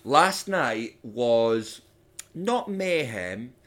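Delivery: Last night was not mayhem, drawn out and slow; slow, deliberate denial of mayhem; slow firm correction. Acted. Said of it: "You told me last night was a bit of mayhem".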